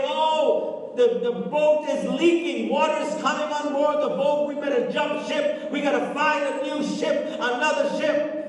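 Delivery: mock someone panicking; panicked deserter. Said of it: "Some will say".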